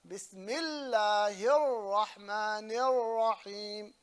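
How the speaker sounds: very slow "Bismillahir Rahmanir Rahim", l held and r rolled; stretched recitation of "Bismillahir Rahmanir Rahim"; emphasis on lam. Shown as a demonstration. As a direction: lean hard on the l of Bismillahir, trill the rs. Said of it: "put emphasis on the lam".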